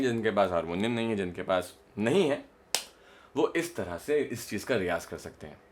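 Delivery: usual speaking voice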